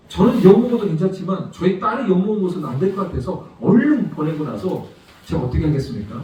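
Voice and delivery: ordinary talk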